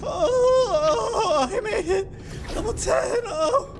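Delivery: overjoyed to escape; relieved cheer. Real, not acted. Player escaping to the next level, relieved cheering.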